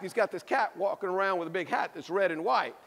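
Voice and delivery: ordinary talk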